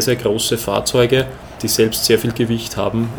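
ordinary talk, nothing marked

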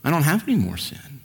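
a hint of a self-assured churchman; mimicking him. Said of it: "what he was saying was".

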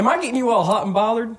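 put-on Southern accent. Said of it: "My fucking accent done changed".

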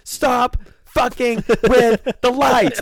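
high, slow imitation of an annoyed waitress; imitating the waitress. Waitress scolding the table, high slow mock warning. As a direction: mimic her fed-up warning, slowly and high. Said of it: "and she goes".

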